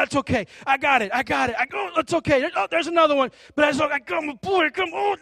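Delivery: high, loud, pained reassurance; dad shielding son. Acted. Dad hugging his son while stones hit him, pained reassurance. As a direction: cry out through blows while comforting your boy.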